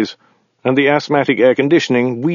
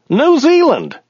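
very high-pitched driver voice; driver character; 4 dB louder, slower than usual. A narrator's reading. Driver confusing countries with his passenger, very high character voice.

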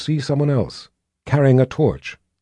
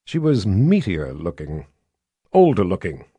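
plain reading voice

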